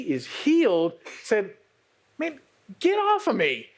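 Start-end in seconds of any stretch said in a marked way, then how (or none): 2.2-3.7 high, lively retold shout-off; playful mock indignation; mock indignant quote. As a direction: toss it off high and sassy. Healed woman tells off crowd.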